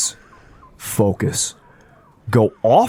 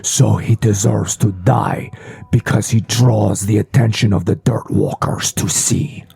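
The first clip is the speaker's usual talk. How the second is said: whispering fish creature. Acted.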